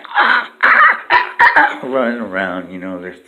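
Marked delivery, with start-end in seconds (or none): 0.1-1.8 very high, loud monkey chatter; monkey screech. Retold or imitated. Monkeys at the zoo, very high loud screeching. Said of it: "them monkeys is like".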